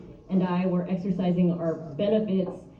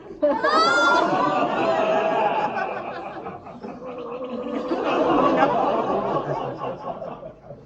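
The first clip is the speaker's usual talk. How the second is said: airy wheezing laugh, much higher; breathless laugh; 9 semitones higher than usual. Real, not acted. Comic wheezing at her own insult, airy laugh.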